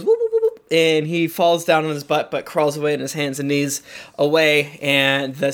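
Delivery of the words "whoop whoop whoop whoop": very high, panicked squealing whoops. Acted. Radish seller drops his load and squeals high. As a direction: yelp in falsetto like a spooked farmer. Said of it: "He, he goes".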